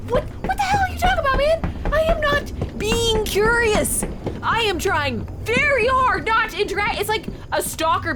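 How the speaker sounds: very high, indignant rant; exasperated outburst. Real, not acted. Player protests to the stalker-like stranger, exasperated. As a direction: explode in high-pitched disbelief. Player rants at game character.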